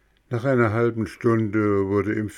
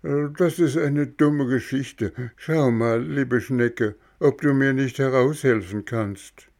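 high, eager puppet voice; a small puppet insisting on who he is; puppet boy voice; 6 semitones higher than usual. Acted. Bengele bittet verlegen. (hohe, eifrige Hampelmannstimme)

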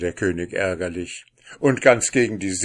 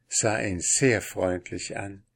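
usual speaking voice

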